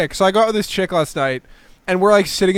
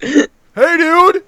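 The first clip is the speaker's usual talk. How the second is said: imitating an overloud bar guy, high and loud; mock loud greeting; 9 semitones higher, 7 dB louder than usual. Beery guy walking up at the bar, loud mock greeting. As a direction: boom it out like an overfriendly drinker.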